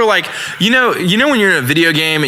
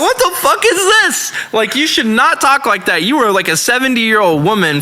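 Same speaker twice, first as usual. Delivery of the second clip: incredulous scolding; 7 semitones higher than usual. Retold or imitated. Bouncer scolding a seventy-year-old for her talk, incredulous. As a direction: start pitched way up, then settle into telling her off.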